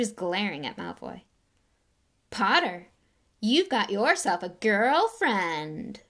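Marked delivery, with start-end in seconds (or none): 2.3-6.0 slow, louder sneering drawl. Acted. Draco to Harry, drawling a taunt about Ginny. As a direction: stretch it out to mock. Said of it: "drawled Malfoy".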